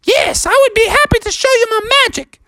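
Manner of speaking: squeaky, very high falsetto; high-pitched magical creature; Mariana the narwhal. Acted. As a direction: oblige him in a tiny sea-creature voice. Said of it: "And she said".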